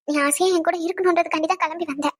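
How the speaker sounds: ordinary delivery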